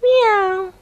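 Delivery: very high squeaky meow; a tiny, pleading kitten mew. Acted. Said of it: "said the hungry little kitten".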